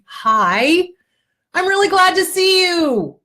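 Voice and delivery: glad greeting. Shown as a demonstration. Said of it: "you make eye contact with yourself and you say".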